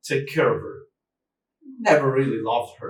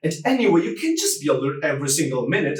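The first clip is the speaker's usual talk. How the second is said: normal talking voice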